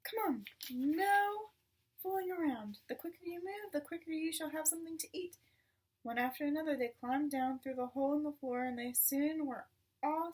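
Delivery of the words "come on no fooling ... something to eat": urging. A narrator's reading. Father hurrying the cubs.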